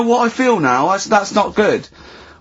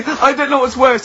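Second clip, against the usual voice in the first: ordinary delivery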